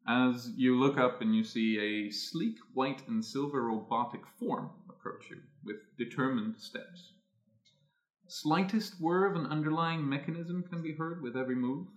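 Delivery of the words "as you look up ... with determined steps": ordinary talk, nothing marked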